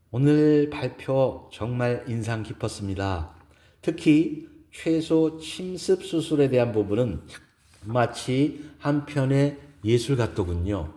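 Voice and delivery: lifted, courteous character voice; admiring woman character. Acted. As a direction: voice a woman paying a formal compliment. Narrator voices admiring female character.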